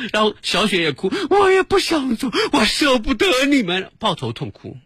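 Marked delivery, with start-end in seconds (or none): 1.3-3.9 put-on much higher whiny child voice; imitating a tearful child. Narrator voices a girl begging to stay. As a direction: whimper it like a kid who won't leave. Child crying not to leave.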